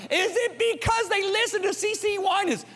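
very high, incredulous-sounding challenge; escalating rhetorical grilling; dad grilling sons. Retold or imitated. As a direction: push the question way up high. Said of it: "I said".

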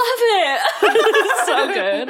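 very high, drawn-out mock squeal; gushing imitation. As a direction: stretch it out high in hammed-up adoration. Acting out delighted film reply.

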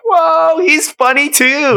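high, drawn-out mock amazement; amazed crowd reaction. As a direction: voice the wowed audience, stretched and high. Voices everybody's surprised reaction to film.

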